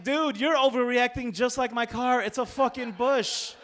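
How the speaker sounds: loud, high-pitched exasperation; exasperated protest. Retold or imitated. Driver arguing with bystander over a bush, exasperated. Arguing with man calling cops.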